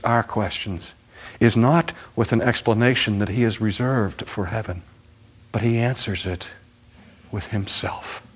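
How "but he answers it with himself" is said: ordinary talk, nothing marked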